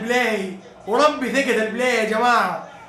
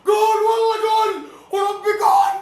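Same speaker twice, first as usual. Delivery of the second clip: goal scream; 11 semitones higher than usual. Real, not acted. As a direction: explode with joy at the goal. Goal scored, fan screams.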